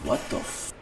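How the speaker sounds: breathy shocked whisper; whispered disbelief. Retold or imitated. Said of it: "I'm like".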